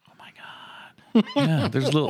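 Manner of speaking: hammed-up shocked gasp, high; mock surprise. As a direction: act stunned by the obvious. Mock shock at obvious point.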